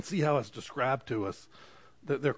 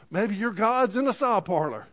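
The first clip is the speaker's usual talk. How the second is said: higher, sarcastic jeer; mock taunt; 7 semitones higher, 3 dB louder than usual. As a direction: needle them with a mocking jab. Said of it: "he'd yell at them".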